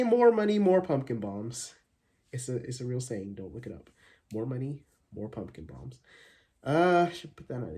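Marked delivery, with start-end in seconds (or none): 0.0-1.7 high, loud delivery easing down; mock proverb. Vlogger quoting a made-up saying, mock-proverbial. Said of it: "like they say". 4.3-6.0 softer, offhand repeat; understated mock proverb. Vlogger repeating his joke saying, understated.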